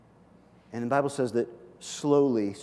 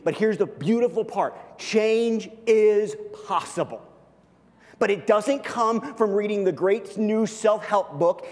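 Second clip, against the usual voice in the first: higher, louder, driving sermon voice; full-force preaching; 9 semitones higher, 5 dB louder than usual. Real, not acted. Pastor pressing the congregation about forgiveness and change, higher and louder. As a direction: preach it with rising conviction.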